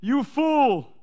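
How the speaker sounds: high, loud, slow divine rebuke; God rebuking the wealthy man, loud and slow; stern God voice. Retold or imitated. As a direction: pronounce judgment on the rich fool. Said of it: "He said to the wealthy guy".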